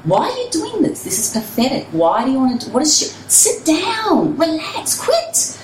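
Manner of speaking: a high, whiny inner critic telling her to quit; nagging inner voice. Retold or imitated. Runner recalling the voice in her head urging her to quit, high and whiny. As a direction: let the doubting voice in your head mock you. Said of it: "I had this voice in my head saying".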